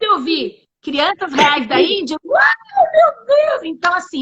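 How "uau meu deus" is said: high, louder exclamation of awe; retold amazement. Guest relives first seeing the video, amazed high cry.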